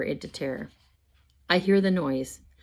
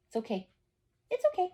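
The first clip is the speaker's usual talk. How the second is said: soothing the dog; 13 semitones higher, 7 dB quieter, faster than usual. Real, not acted. Calming the dog.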